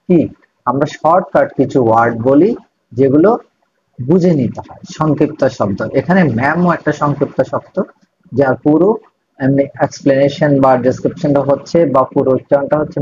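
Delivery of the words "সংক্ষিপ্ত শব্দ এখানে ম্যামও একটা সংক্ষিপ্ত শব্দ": ordinary talk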